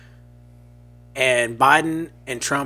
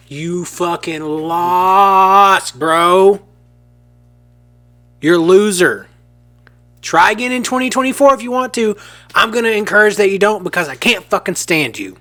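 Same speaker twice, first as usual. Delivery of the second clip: louder, angry telling-off; furious heel rant; 4 semitones higher, 4 dB louder than usual. Acted. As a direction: tear into the boss. Manager berates Trump, fired up.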